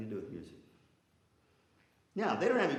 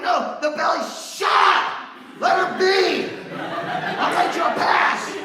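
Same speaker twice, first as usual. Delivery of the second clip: much higher and louder, slow laughing yell; laughing mock shout; 12 dB louder, much slower than usual. The director yelling at students after the bell, laughing. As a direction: shout the teacher's reply through laughter.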